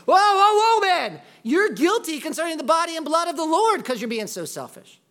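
a high, loud whoa whoa whoa, then a hammed-up rebuke; man in a buffet line scolding a queue-jumper, mock outrage; mock outraged diner. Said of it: "Paul's not saying".

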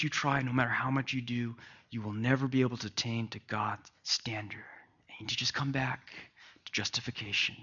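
near-whispered, soft sermon lines; hushed preaching. Real, not acted. Pastor hushes the congregation on a truth. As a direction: drop to a breathy hush to draw them in.

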